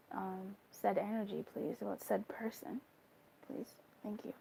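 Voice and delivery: soft, hushed asking for guidance; quiet prayer. Acted. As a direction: murmur it like a private invocation. Asks the cards for guidance.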